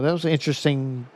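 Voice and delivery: normal talking voice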